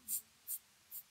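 soft tongue clicks tsk tsk tsk; monkey tsk. Acted. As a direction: click the tongue back at him.

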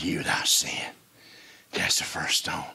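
very low, soft whisper; solemn whisper. Acted. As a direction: breathe it as holy words. Jesus quoted again hushed.

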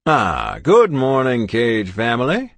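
put-on jovial old doctor, booming hello; kindly old doctor voice; cheery doctor. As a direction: greet them like a beaming family doctor. Elderly doctor greets the couple.

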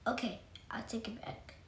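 very soft whispered apology; sheepish whisper. Real, not acted. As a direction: whisper it so she keeps going. Backing down after interrupting.

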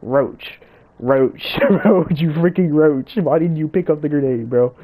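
loud, exasperated rant at a teammate; annoyed yelling. Real, not acted. Gamer yells at teammate Roach for skipping the grenade, loud and exasperated. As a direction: chew him out loudly. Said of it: "I am so annoyed right now".

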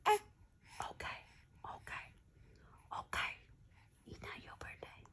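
soft whispered aside; hushed playful correction; whispered tease. Real, not acted.

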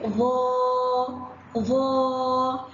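ظا with a long drawn-out a; ظا stretched long; long vowel ظا. Shown as a demonstration. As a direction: hold the vowel of ظا. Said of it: "ظا مد بالألف".

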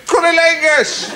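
Cunnilingus! yelled out high; shouted. Shown as a demonstration. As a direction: belt out Cunnilingus! for all to hear. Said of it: "I'll shout it".

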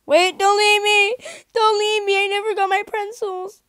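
high, loud desperate call; shouting. Pretend. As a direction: yell after them not to go. Calling after someone leaving.

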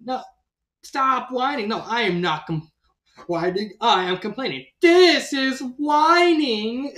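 hammed-up whiny protest; whining. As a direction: imitate a complainer whining in protest, stretching the last words. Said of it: "like, whining".

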